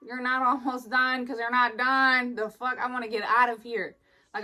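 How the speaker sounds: normal talking voice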